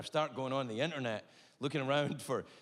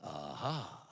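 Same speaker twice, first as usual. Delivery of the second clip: doctor voice; 12 semitones lower, 5 dB quieter than usual. Acted. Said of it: "The doctor looked at my eye, and he went".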